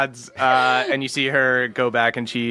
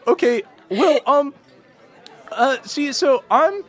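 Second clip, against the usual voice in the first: much higher, rambling, stammering voice; higher, flustered halfling with sudden fast bursts; nervous halfling scoutmaster; 11 semitones higher, faster than usual. Acted. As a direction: blurt out the whole sorry story.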